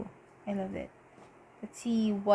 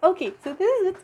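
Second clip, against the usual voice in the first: excited rush; 9 semitones higher, 5 dB louder, faster than usual. Real, not acted. Excited start of reading.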